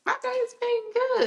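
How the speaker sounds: very high, slow, sing-song lilt. Real, not acted. Guest telling the host about her day, sing-song. Guest's sudden sung-up line.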